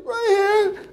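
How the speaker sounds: high, loud tearful whine; hammed-up sobbing child's reply; obey through mock tears; crying boy. Crying boy shows the hurt spot.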